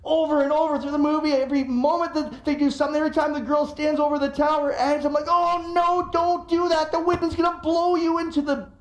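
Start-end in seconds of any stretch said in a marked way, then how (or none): 5.3-8.7 higher, pleading alarm; mock alarm. Warning the movie girl off the edge, higher pleading alarm. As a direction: cry out at the screen in fright.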